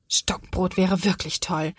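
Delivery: usual speaking voice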